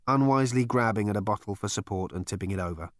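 usual speaking voice

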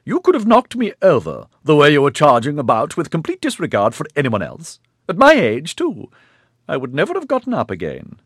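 put-on high, loud indignant old lady; stern old matriarch. A narrator's reading. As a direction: berate him in a wavering old voice. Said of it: "her wavery voice".

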